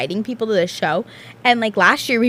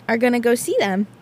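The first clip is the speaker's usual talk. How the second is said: normal talking voice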